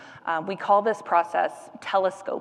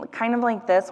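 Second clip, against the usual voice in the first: hypernasal demo, a bit higher, air through the nose; too nasal; 4 semitones higher than usual. Shown as a demonstration. Therapist demos a hypernasal voice to viewers, too nasal. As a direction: push the sound up into the nose. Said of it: "your voice sounds more nasal".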